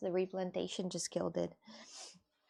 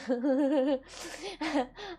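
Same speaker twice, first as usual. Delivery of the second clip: laugh; 6 semitones higher than usual. Real, not acted. Laughing at own joke.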